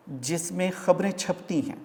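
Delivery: normal talking voice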